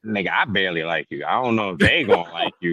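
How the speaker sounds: low-pitched, reluctant brush-off; Dom. Retold or imitated. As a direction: imitate an unimpressed friend, voice dropped. Acting out Dom brushing off Carlito.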